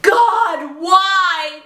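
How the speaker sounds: hammed-up anguished shout; mock kids screaming. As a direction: yell it up at God in despair. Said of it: "There were kids screaming".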